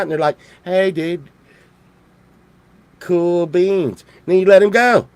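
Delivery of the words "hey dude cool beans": laid-back toad, dragging out the pauses; reply like a mellow, easygoing dude; chill horny toad. Acted. Toad to the one who caught it, chill. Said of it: "they're really chill, man".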